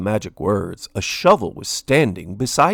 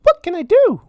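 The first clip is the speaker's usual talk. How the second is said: high, louder mouse voice pleading; Minnie pleading; 8 dB louder than usual. A narrator's reading. Minnie begging Lord Gurr, high pleading mouse voice. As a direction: beg sweetly for food. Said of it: "she cried".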